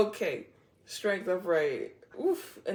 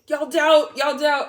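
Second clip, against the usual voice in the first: higher, much louder sassy call; teasing shout; 7 semitones higher, 11 dB louder, slower than usual. Real, not acted. Reader teasing doubtful viewers, loud sassy call.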